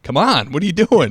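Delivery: rising, exasperated come on; impatient exasperation. Retold or imitated. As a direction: let it climb in disbelief. Impatient trainer voiced.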